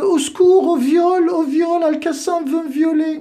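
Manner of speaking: high shrill mock-panic falsetto, loud; hammed-up damsel screaming for help; mock cry for help. Mocking the opponent who calls for help on the chat, in a high falsetto.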